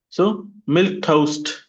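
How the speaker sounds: milk toast, hitting the word milk; slow drill with stress on milk; teacher models it for the class to repeat. Shown as a demonstration. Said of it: "repeat after me".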